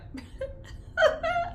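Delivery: a high laugh breaking into a sob; a real high laugh with crying in it. Real, not acted. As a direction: laugh through tears. Laughing at her pity party.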